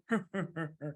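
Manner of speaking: low staged chuckle; mouse's chuckle. Acted. Mouse has fooled the fox, sly chuckle. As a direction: chuckle slyly at the trick.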